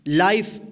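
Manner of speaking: लाइफ as one syllable, ending on f; correct silent e. Shown as a demonstration. Teacher answering how to read it, correctly pronounced. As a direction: say लाइफ with "ai" and no final vowel. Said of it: "क्या पढ़ेंगे?".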